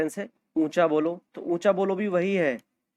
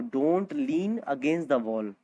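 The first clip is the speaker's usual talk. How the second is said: slow model sentence; much slower than usual. Shown as a demonstration. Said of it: "slow model sentence for learners".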